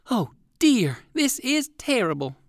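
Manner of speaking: high, dismayed cry; an old rabbit gasping at bad news; exclaiming old rabbit. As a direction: throw up your hands in dismay. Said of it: "exclaimed Uncle Wiggily".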